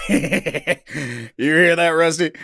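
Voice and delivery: loud, gruff mocking human; gruff accented voice. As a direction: call out gruffly, amused. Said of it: "a gruff, accented voice echoed".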